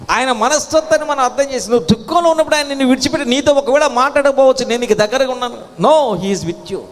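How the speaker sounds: high, pressing sermon delivery; fervent preaching. Real, not acted. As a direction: press the promise on the congregation. Preaches emphatically.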